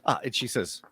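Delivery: ordinary talk, nothing marked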